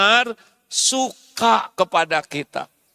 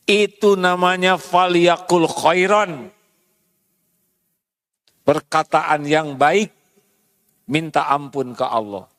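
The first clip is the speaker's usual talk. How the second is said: normal talking voice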